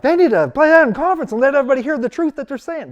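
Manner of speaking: exclaiming loudly. Retold or imitated. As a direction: blurt it out, indignant and fed up. Retelling his urge to expose it.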